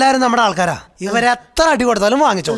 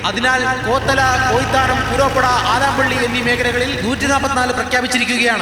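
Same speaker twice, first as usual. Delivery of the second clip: official notice over a loudspeaker; public announcement. Acted. Official warns villagers of curfew, loudspeaker announcement. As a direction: read it like a curfew broadcast.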